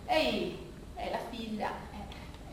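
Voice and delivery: ordinary talk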